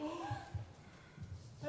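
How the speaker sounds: a high breathless gasp; sharp waking gasp. Acted. Lysander waking to see Helena, startled gasp. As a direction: gasp awake as if struck. Lysander wakes in sudden love.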